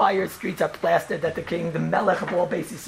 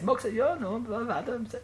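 soft, higher, slowed retold reply; understated, casual ruling; simple offhand ruling; 6 semitones higher, 5 dB quieter, slower than usual. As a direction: imitate an unfussy answer, said quietly. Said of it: "So simple".

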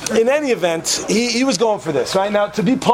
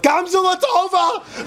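high drawn-out call "Gamzu Latova!"; yelled out; 13 semitones higher, slower than usual. Retold or imitated. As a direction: call it out across the plane.

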